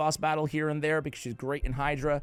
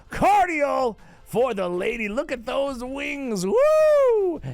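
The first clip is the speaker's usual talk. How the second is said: hammy seductive host; 10 semitones higher, 6 dB louder, slower than usual. Pretend. Hammed-up wing showcase to ladies.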